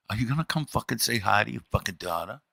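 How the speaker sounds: hushed, fast, furious whisper; a wife's seething whisper to her husband, hushed and fast; angry whisper. Retold or imitated. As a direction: hiss it so the guests won't hear. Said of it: "in that same whisper".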